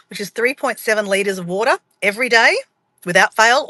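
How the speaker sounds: usual speaking voice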